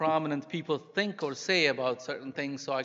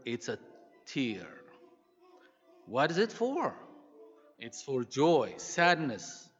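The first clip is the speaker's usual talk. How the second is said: warm, steady storytelling read; story reading. Acted. Preacher reading a story about mothers to the church, warmly. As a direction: tell the tale to the congregation with feeling. Reads story aloud to congregation.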